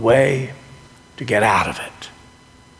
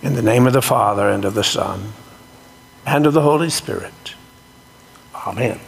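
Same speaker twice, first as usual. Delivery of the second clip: solemn blessing; 2 semitones lower, faster than usual. Acted. Closing Trinitarian blessing.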